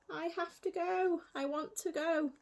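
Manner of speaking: high, anxious little voice; lightly voiced worried girl; Cinderella's voice. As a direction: Cinderella fretting to leave.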